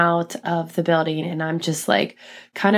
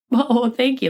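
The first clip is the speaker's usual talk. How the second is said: higher, moved thank-you; touched thanks; 6 semitones higher than usual. Retold or imitated. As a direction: thank him as if close to tears. Mom thanking a kind parent.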